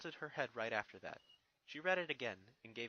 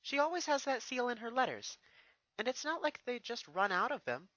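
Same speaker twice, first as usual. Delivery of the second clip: higher, lightly voiced young mare; a thoughtful pony princess, pitched up; Twilight's voice; 11 semitones higher, 7 dB louder than usual. Twilight answers Spike, lightly voiced and higher.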